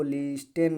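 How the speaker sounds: लिस्टेन with the t fully sounded, "lis-ten"; wrong pronunciation. Shown as a demonstration. Teacher showing students the reading to avoid, t sounded. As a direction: say लिस्टेन with a hard, audible t. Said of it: "नहीं पढ़ा जाएगा".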